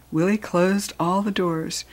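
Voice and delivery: usual speaking voice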